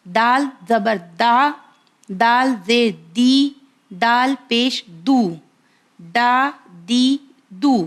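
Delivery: دال زبر دا said slow and clear; letter drill. Shown as a demonstration. Teacher drills viewers on دال, slow and clear. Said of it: "اس کا sound کیسے نکلے گا".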